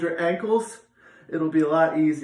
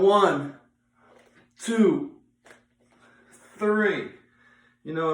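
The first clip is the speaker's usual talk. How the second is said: counting reps; 3 semitones higher, slower than usual. Real, not acted. Coach counting reps aloud.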